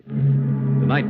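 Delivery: ordinary delivery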